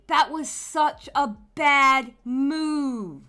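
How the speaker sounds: slow emphatic drawl. Real, not acted. Drawn-out emphatic judgment.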